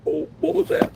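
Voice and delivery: sudden high startled cry; startled squeal. Real, not acted. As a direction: jump at the sudden pop. Startled by candy wrapper popping.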